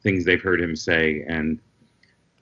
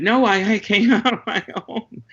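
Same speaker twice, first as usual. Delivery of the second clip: high defensive protest; 11 semitones higher than usual. Retold or imitated. Defending himself to accuser.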